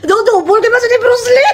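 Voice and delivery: hooting at a funny photo; laughing shriek. Real, not acted. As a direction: shriek it while cracking up. Shrieking tease at fan's photo.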